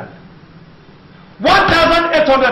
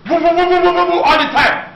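imitated AC roar; 4 semitones higher than usual. Imitates air conditioner noise.

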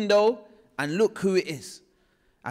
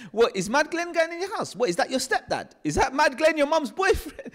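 mocking taunt; 10 semitones higher than usual. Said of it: "they'd laugh at me and they'll be like".

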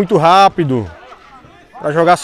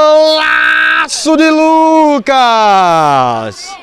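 high drawn-out golaço shout; explosive goal commentary; 6 semitones higher, much slower than usual. Real, not acted. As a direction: stretch out the goal yell.

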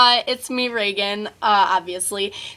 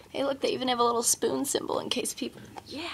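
whispering; 3 semitones higher, 6 dB quieter, faster than usual. Real, not acted. Aside to camera about spoon.

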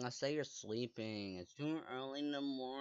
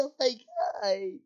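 high, loud "oh my God"; excited exclamation; 9 semitones higher, 10 dB louder than usual. Real, not acted. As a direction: gasp it out in glee. Excited reaction.

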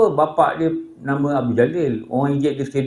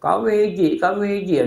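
put-on complaining child; 6 semitones higher than usual. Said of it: "anak dia kata".

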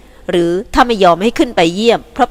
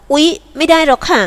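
put-on high startled young woman's cry; a quick alarmed exclamation; 8 semitones higher, 4 dB louder than usual. A narrator's reading. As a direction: gasp it out in alarm. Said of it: "โลมฤทัยร้องอย่างตกอกตกใจ".

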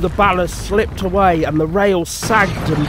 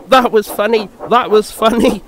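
high, loud mock chuckle in the voice; chuckling; 6 semitones higher, 5 dB louder than usual. A narrator's reading. Thomas is pleased with himself, mock chuckling. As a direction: say it as if chuckling, pitched up. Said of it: "he chuckled".

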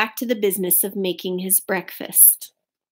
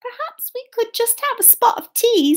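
very high little mouse voice; tiny high-pitched polite mouse; timid mouse; 13 semitones higher than usual. A narrator's reading. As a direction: squeak a polite, hopeful request. Mouse's hopeful request to bear.

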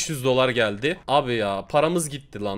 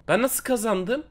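stunned question; 7 semitones higher than usual. Real, not acted. Disbelief after winning.

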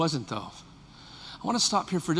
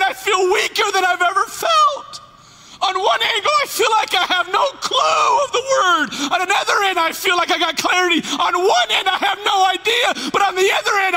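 crying out; 13 semitones higher, 7 dB louder than usual. Real, not acted. Preacher cries out mid-sermon.

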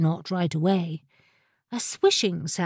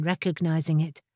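usual speaking voice

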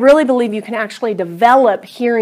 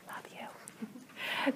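breathy whispered love you; whispered aside; 28 dB quieter than usual. Real, not acted.

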